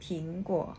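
"ผิงกัว" said as Mandarin ping-guo with a rising end; "ผิงกัว" in Chinese tones; Mandarin reading. Shown as a demonstration. Teacher giving the Chinese name for Apple, Mandarin tones. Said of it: "คนจีนอ่านว่า".

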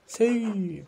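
high cheering yes; excited cry. Acted. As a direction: shout agreement from the crowd. Crowd voice cheering.

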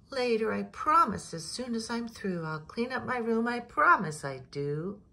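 high, loud sing-song promise; pleading child. A narrator's reading. Jillian promises her mother to clean later, high and eager.

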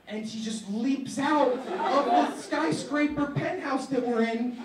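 loud, high telling through laughter; closing the dream story, loud and high; excited climax. Real, not acted. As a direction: ride the punchline at full volume. Punchline told loud and high.

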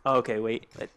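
ordinary talk, nothing marked

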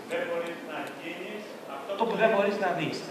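soft, slow, distant quote; quoted line. Shown as a demonstration. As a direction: read it like a hushed quotation. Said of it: "πώς το έγραψε στο βιβλίο". (σιγανό, αργό απόσπασμα από βιβλίο)

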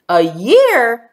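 put-on loud, startled child's shout. A narrator's reading. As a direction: shout it out in dismay. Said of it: "shouted Tanya".

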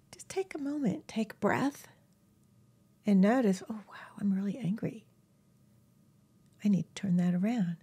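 soft whispered guidance to breathe; calming whisper. Acted. Guiding viewers through anger in a soft whisper.

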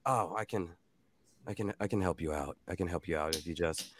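soft, low and halting; gentle offering dad. Acted. Older dad offering to help the wounded, soft and low. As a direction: offer help quietly, words tumbling a little. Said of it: "and he's like".

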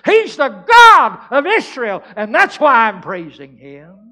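huge, slow revival shout; full-force preacher's roar of praise; triumphant proclamation. Acted. Climax of acted testimony.

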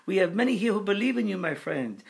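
put-on high, loud French-accented proclamation; imitating an excitable French spirit, pitched high; screaming Frenchman. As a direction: call out fervently in a French accent. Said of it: "and screaming".

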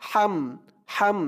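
"হাম" with a thin H from inside the throat; thin throaty H. Shown as a demonstration. Teacher showing the letter to learners, "হাম" with thin throaty H. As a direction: bring the H of "হাম" thinly out of the throat. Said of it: "গলার ভিতর থেকে চিকুন করে".

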